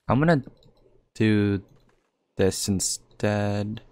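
ordinary talk

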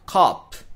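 "カップ" as English cop, long open ah; English cop vowel. Shown as a demonstration. Teacher shows the policeman word, open-vowel cop. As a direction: stretch the middle vowel of "カップ", bare final p. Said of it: "この真ん中の音はカップ".

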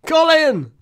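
sudden very high, loud yell; really loud shout. Real, not acted. Gamer blurts out a player's name mid-substitutions, really loud shout. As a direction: yell the name out at full volume. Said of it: "So that was really loud".

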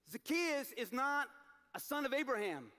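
normal talking voice

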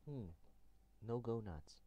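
ordinary delivery

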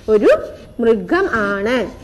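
word by word with pauses, drawn out; slow model answer. Shown as a demonstration. Teacher gives answer for pupils to copy, slow model answer. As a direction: separate each word carefully.

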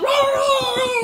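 high, loud yelp of alarm; startled whoa. Retold or imitated. Reliving a stoned run from friends, loud high whoa. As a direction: yelp as if spooked by a chase. Retold fleeing while stoned.

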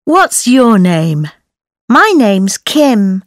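ordinary delivery

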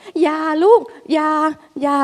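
high, slow scolding parent; imitating a nagging mother, drawn out. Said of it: "ไม่ต้องมีเสียงแบบ".

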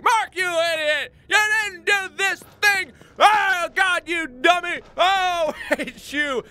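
high, loud, slow hammed-up yelling; exaggerated squealing viewer tirade; mock raging commenters. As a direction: yell it like an exasperated heckler. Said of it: "like, in the comments".

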